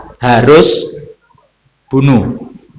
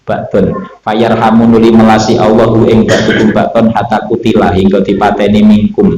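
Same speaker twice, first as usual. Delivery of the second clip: steady, level-toned reading, lower than the talk; scripture recitation with gloss; 4 semitones lower than usual. A narrator's reading. Teacher reading Arabic text with Javanese translation, steady intoned recitation. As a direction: intone the text phrase by phrase in a monotone.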